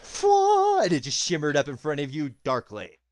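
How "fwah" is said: high vocal whoosh sound; a sudden shimmer noise; whoosh effect. Acted. Game master describing entering a zone, high whoosh.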